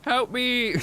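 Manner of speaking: high-pitched pretend plea for help; mock cry for help. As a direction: yelp it like a trapped victim. Mock trapped, calling for rescue.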